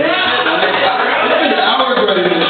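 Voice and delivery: loud, high comic rant; worked-up, hammed-up complaint to the crowd; mock outrage. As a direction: protest at full volume, exasperated. Mock outrage at audience's request.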